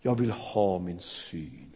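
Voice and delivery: low, slow, soft request; quiet plea. Acted. The blind beggar answering Jesus, low, slow and soft. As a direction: say it simply, hushed.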